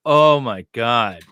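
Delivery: loud, slow exclamation; shocked oh my God. Real, not acted. Host reacting to a line in the book, shocked. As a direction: gasp it out in disbelief.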